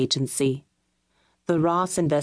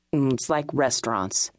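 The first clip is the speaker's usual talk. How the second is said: lower, matter-of-fact man voice; flat statement; 3 semitones lower than usual. A narrator's reading. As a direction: state it low and blunt.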